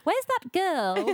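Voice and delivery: put-on slow, high, haughty old dame with a laugh; imperious plummy grande dame. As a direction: call for the maid like a grand lady. Voicing Gloria.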